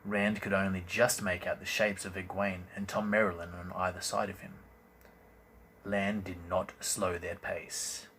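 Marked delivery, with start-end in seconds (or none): none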